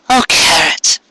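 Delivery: Cupcake troubled. A narrator's reading. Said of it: "she says".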